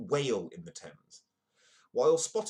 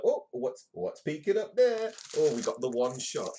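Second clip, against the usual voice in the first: much higher, louder gleeful mock photographer; mock paparazzi; 5 semitones higher, 6 dB louder than usual. Paparazzi delighting in a revealing photo, hammed-up. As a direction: gasp it out like a sleazy snapper spotting a shot. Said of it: "They're like".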